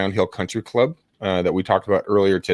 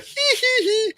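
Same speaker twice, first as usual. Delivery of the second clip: nervous giggle; slower than usual. Retold or imitated. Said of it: "I'd be like".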